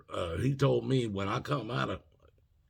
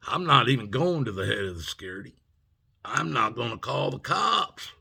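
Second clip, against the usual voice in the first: raised, higher-pitched retold warning; retold confrontation; 5 semitones higher, 6 dB louder than usual. Student warns professor about towing his Bronco, confrontational. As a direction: stand your ground, voice lifted, retelling it firmly.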